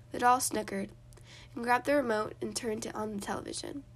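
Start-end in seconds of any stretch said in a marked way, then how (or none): none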